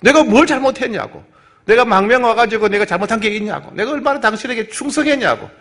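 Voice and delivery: put-on indignant protest, pitched high; mock temper, high insistent voice; feigned anger. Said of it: "성질을 내는 척 하는 거예요".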